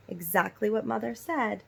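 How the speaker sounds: plain reading voice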